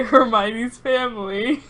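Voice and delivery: usual speaking voice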